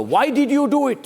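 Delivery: pointed, accusing challenge; accusatory question. Acted. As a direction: demand an answer from a colleague. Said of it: "I just emphasize in my own".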